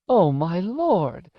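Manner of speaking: put-on high, drawn-out old woman's wail; old lady aghast; shocked cry. A narrator's reading. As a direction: cry out slowly, high, in dismay. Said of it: "Missus Griffin cried when she set eyes".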